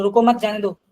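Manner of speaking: "रुको मत, जाने दो" run straight through without a break; no pause. Shown as a demonstration. Teacher showing students how meaning gets unclear, no pause. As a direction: say "रुको मत, जाने दो" in one unbroken phrase. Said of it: "मैं कहीं रुकी नहीं".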